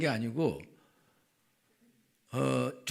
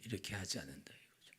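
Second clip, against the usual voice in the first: whispered conclusion; 7 semitones lower, 16 dB quieter than usual. Real, not acted. Trailing conclusion.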